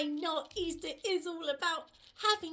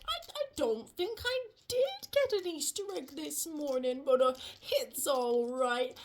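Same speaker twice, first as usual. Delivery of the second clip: low frog voice, hesitant then accepting; easygoing frog; 9 semitones lower than usual. Acted. Froggy telling Rabbit he got no Easter egg, easygoing frog. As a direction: play a low-pitched frog brushing off a disappointment.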